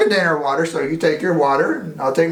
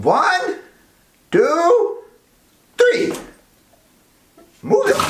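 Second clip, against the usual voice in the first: building countdown; 14 semitones higher, much slower than usual. Real, not acted. Counting down the big reveal.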